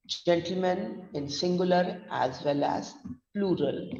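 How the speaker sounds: usual speaking voice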